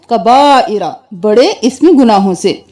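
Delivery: ordinary talk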